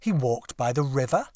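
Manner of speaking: ordinary delivery, nothing marked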